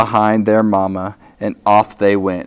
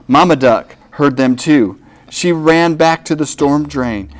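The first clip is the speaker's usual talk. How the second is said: usual speaking voice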